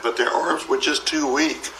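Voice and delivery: ordinary delivery, nothing marked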